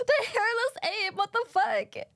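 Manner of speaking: high, indignant horse yelp; mock horse voice. Horse shouting at the human.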